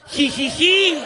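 high, loud mocking "jijiji" giggle; a smug little texting giggle, hammed up. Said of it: "así teclean las mujeres". (risita burlona "jijiji")

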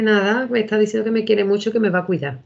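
a hint of an earnest small boy; lightly voiced little boy. Five-year-old son telling mum about grandma, a light childlike voice. Son's answer retold.

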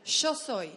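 "Yo soy" said sho soy, loud; Argentine sh. Shown as a demonstration. Demonstrating the accent for the class, Argentine sh. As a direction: hit "Yo soy" with Y as a strong sh. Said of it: "sale bien el acento argentino".

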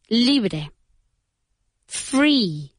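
ordinary talk

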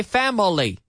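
wrong family with an extra middle syllable, high; mispronounced, three syllables. Shown as a demonstration. Teacher showing learners the mistake, three syllables. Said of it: "We don't say family".

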